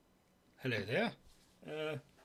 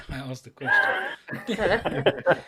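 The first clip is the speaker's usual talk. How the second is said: words stretched out through a laugh; 8 semitones higher, 9 dB louder, much slower than usual. Real, not acted. Player cracking up at the GM, laughing. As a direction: laugh your way through it slowly.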